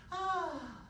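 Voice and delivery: high, loud sighed "ah"; short sigh stuck up high; head-voice sigh. Shown as a demonstration. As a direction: sigh that can't reach the low notes. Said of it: "That's as low as I can go".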